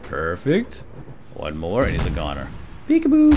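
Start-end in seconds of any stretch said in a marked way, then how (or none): 2.9-3.3 high singsong peekaboo; sudden high teasing call; playful taunt. Real, not acted. Taunting hidden enemy.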